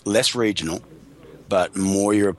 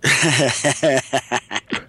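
long, amused laugh; real laugh; 5 semitones higher than usual. Real, not acted. Guest amused by question about the spa scene, laughing. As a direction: let out a sustained laugh, a bit higher.

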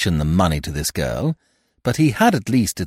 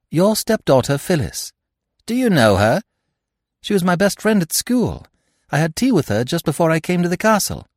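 put-on young woman; 8 semitones higher than usual. A narrator's reading. Eve speaks, higher voice.

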